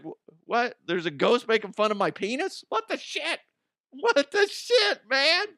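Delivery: freaked-out disbelief. Pretend. Person hearing a ghost mock them, high shocked panic. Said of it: "And you're like".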